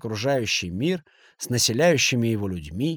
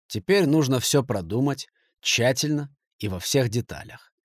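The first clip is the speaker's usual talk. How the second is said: usual speaking voice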